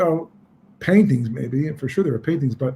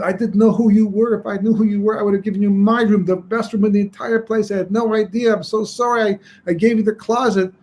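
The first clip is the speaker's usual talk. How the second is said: tearful apology; 6 semitones higher than usual. Acted. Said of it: "he begins to cry like a, like a child".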